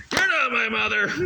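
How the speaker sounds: high, loud bratty shout; shrill mock tantrum; mock drunken yell. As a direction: yell it back like a defiant drunk teen. Drunk kid yelling at friend.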